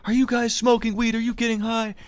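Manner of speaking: high-pitched mock mother voice; high, questioning mom impression; suspicious mom. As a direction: imitate a prying mom, voice way up. Mom catches boys smoking.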